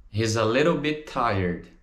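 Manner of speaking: "He's a little bit tired" in one linked run; joined up. Shown as a demonstration. Teacher repeats the answer, words flowing together.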